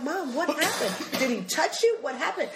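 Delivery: high, anxious-daughter questions; acted worry over mom; alarmed questioning. Alarmed daughter questioning her mother.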